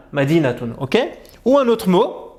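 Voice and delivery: ordinary delivery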